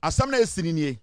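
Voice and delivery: normal talking voice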